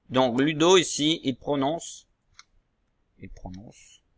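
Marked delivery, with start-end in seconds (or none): none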